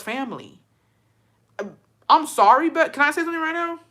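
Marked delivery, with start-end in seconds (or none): 2.1-3.8 high, fast, eager cut-in; urgent interruption. Real, not acted. To viewers, cutting in fast and high. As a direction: jump in before anyone stops you. Bursting in with an objection.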